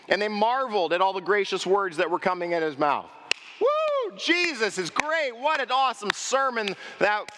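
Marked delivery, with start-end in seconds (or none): 3.6-7.3 very high whooping praise; cheering crowd. Retold or imitated. The crowd gushing over Jesus's sermon, high and excited. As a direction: cheer him on, carried away. Voicing impressed synagogue crowd.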